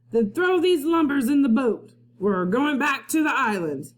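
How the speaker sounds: a pirate captain shouting orders. Acted. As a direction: order the crew about like a pirate boss.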